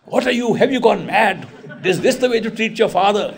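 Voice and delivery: high, loud retold outburst of an outraged father; father crying out. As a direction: protest as a dragged-off father. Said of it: "And dad cried".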